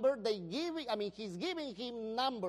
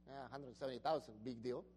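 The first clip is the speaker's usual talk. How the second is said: unimpressed; 11 semitones lower, 8 dB quieter, faster than usual. Retold or imitated. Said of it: "sometimes we don't get excited".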